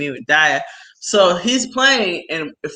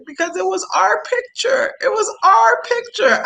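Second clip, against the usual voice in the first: much higher, whiny crying child imitation; imitating crying kid; 14 semitones higher than usual. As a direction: mimic a little boy sobbing over a broken photo. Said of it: "he started crying".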